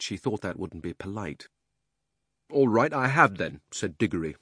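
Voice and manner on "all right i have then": put-on raised, higher boy's outburst; much louder voice. A narrator's reading. Miserable boy admits he has cried, much louder voice. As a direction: blurt it loudly, miserable and past caring. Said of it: "in a much louder voice".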